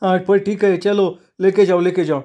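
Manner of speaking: high-pitched, flustered giving in; grudging shopkeeper waving it through; reluctant consent. A narrator's reading. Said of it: "असं म्हणत दादूनं मोठ्या नाईलाजानं".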